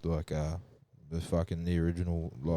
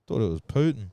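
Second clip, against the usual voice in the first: ordinary delivery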